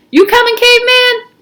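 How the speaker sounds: high, loud call; loud, high friendly call. Acted. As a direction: call out to him from across the room. Squid calls to Stanley.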